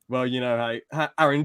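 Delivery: ordinary talk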